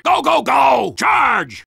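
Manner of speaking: ordinary delivery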